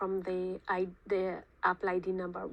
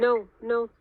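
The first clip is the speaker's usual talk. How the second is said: firm no; 3 semitones higher, 10 dB louder than usual. Real, not acted. Correcting agent's wrong digits.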